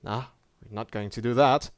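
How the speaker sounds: ordinary delivery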